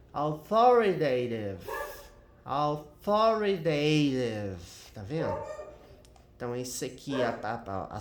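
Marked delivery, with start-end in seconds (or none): 0.1-1.6 Authoritative slowed, with THO punched loud; stress on THO. Shown as a demonstration. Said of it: "a sílaba mais forte é aqui". 2.4-4.6 Authoritative stretched out, THO and TA both stressed. Shown as a demonstration. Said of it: "a sílaba mais forte é aqui".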